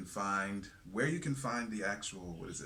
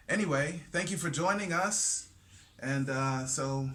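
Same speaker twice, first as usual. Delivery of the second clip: show announcer; 6 semitones higher, 7 dB louder than usual. Acted. Host welcome.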